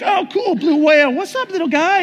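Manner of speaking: very high, cutesy hello; mock nonchalant greeting. Swimmer to a blue whale, mock nonchalant greeting. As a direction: greet it like a tiny pet, high-pitched.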